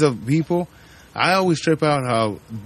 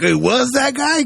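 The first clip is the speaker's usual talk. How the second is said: shocked realization; 11 semitones higher, 4 dB louder than usual. Retold or imitated. Viewer at night realizing the killer's identity, high shocked question. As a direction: pitch shoots up high in disbelief.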